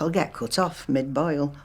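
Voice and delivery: ordinary talk, nothing marked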